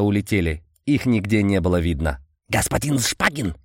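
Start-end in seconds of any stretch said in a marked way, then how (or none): 2.5-3.6 strange clicking insect voice; chittering insect creature. Acted. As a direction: voice a buzzing bug-man. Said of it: "раздался странный стрекочущий голос".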